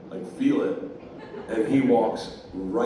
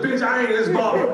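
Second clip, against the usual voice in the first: high, loud mock outrage; hammed-up angry denial; indignant comeback; 9 semitones higher, 6 dB louder than usual. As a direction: snap back as an offended woman. Offended woman snaps back in argument.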